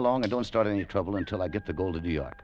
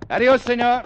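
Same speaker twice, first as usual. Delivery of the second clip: mock Spanish accent; 10 dB louder than usual. Mocks her Spanish farewell.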